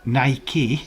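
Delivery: Nike as "nigh-key"; mocked pronunciation. Shown as a demonstration. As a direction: say Nike ending in "ee". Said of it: "doesn't make sense".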